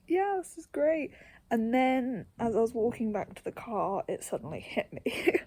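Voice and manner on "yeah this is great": relieved cheer. Retold or imitated. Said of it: "I was like".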